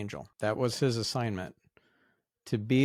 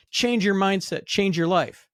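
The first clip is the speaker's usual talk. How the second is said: retold scream; 10 semitones higher, 7 dB louder than usual. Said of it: "he screams at".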